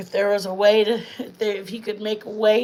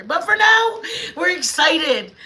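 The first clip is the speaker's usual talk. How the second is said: excited; 9 semitones higher, 4 dB louder, slower than usual. Real, not acted. Announcing happy news to viewers.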